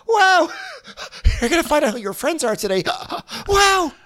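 high, loud mock Rinna; shrill-free hammed-up Rinna imitation. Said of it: "Rinna at Erica's house being like".

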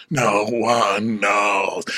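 low, extremely slow intoning; drawn-out refrain. Acted. Answering how long they've been here, low and drawn out. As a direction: stretch each word like a gong. Echoes the drawn-out running joke.

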